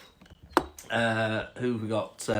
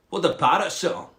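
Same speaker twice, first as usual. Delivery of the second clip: put-on curious frog, Scottish accent; 3 semitones higher than usual. Frog asking the cat about parrots, Scottish accent. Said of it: "a Scottish frog".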